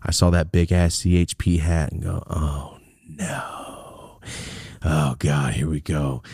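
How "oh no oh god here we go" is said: softer and lower, a sinking oh no; low, dreading groan. Retold or imitated. Watching the hat-wearing cop approach, a low dreading groan. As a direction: mutter it, sensing the trouble.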